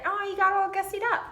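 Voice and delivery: playful tease. Retold or imitated. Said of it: "I'd be like".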